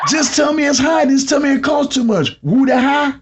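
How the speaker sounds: high-pitched incredulous complaint; exasperated disbelief. Acted. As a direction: protest in comic disbelief, pitch way up. Exasperated rant at customers' slang.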